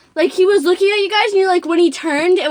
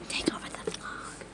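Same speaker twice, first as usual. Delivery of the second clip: soft voiceless whisper; whispered aside to camera; 19 dB quieter than usual. Real, not acted. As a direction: mutter it under your breath. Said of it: "whispered aside".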